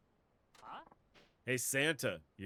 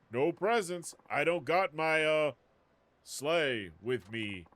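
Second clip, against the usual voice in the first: higher, slower gruff Santa voice; Santa Claus voice; 4 semitones higher, 5 dB louder, slower than usual. Retold or imitated. Streamer imagines Saejima as Santa telling kids, hammed-up Santa voice. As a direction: play a put-upon Santa letting kids down. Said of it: "like, a Santa Claus voice?".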